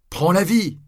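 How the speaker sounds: high, loud urging cry; crowd shout. A narrator's reading. Crowd shouts at the friar on his way, high and loud. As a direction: yell at him to live. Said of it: "ils lui crièrent".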